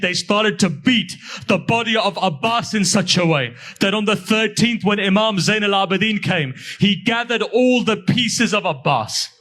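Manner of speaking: high, impassioned majlis recitation; lamenting recitation. Acted. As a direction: pour grief into the climax of the story. Khadim's answer recited as climax.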